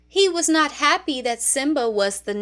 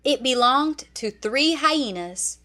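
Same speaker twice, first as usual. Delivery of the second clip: ordinary delivery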